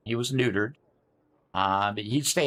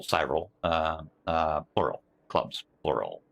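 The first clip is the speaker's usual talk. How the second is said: mock deep voice; 8 semitones lower than usual. Shown as a demonstration. Streamer repeats "clubs, plural" to chat in a mock-deep voice.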